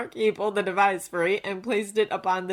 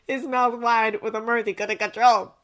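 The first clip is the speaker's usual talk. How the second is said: plain reading voice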